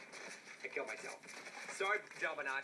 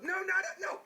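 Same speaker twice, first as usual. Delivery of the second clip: ordinary talk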